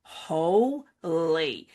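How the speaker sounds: ordinary talk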